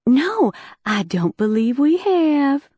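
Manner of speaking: slow, sweet Southern drawl; Southern accent. Acted. Spy in disguise answers Preston in Southern accent. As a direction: lean into a put-on Southern accent to hide her identity. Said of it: "I said in my best Southern accent".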